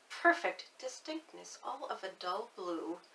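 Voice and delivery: plain reading voice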